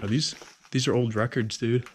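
ordinary talk

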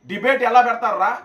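ordinary talk, nothing marked

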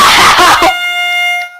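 high-pitched burst of laughter; shrieking laugh. Real, not acted. Laugh after gloating. (หัวเราะเสียงแหลมสุดเสียง)

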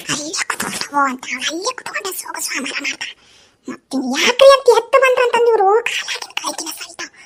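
soft grumble, partly whispered; muttered aside. Acted. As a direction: complain under your breath. Mother-in-law grumbles aside about daughter-in-law. (ತನ್ನಷ್ಟಕ್ಕೆ ಪಿಸುಮಾತಿನಲ್ಲಿ ಗೊಣಗುವುದು)

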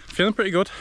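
bright lift on the words; cheerful. Real, not acted. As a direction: sound upbeat and pleased. Upbeat remark mid-run.

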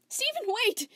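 very high, loud call. Acted. Spinel calling Steven back, pleading shout. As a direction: shout after him.

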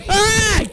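short, high yelp of fright; startled yelp. Real, not acted. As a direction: yelp as if caught off guard. Startled by the game.

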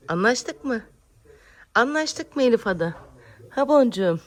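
high, loud cooing question; playful coaxing. Real, not acted. Mother playfully asking her baby to agree. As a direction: coax a smile from her.

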